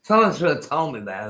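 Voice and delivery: normal talking voice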